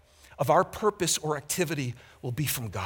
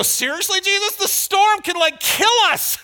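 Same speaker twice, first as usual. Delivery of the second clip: high, loud, indignant disciple; exasperated protest; 11 semitones higher, 8 dB louder than usual. Retold or imitated. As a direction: complain at the top of your voice like a scared fisherman. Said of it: "I always wanted to go".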